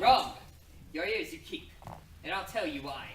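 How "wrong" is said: loud, punched single word; sharp retort. Acted. Hero cutting off the prince, loud and sharp. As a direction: slam the word down to cut him off.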